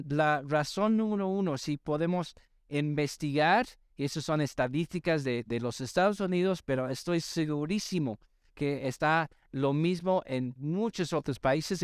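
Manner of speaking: ordinary talk, nothing marked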